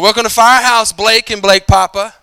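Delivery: loud, high greeting, slowing on the names; shouted welcome. Retold or imitated. Owner greeting the pastor and his dad, loud and high. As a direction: hail the father and son as they walk in. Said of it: "He said".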